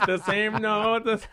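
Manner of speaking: amused at yourself, laughing it out; giggling at the joke. Real, not acted. Laughs at own repeated notes.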